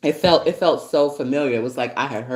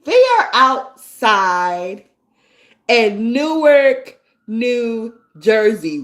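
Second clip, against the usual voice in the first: high, loud, drawn-out disbelief; incredulous outrage; 8 semitones higher, 6 dB louder, much slower than usual. Real, not acted. Venting to viewers about the street, high and slow in disbelief. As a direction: stretch each word out in shock. Shocked rant about neighbor's kid.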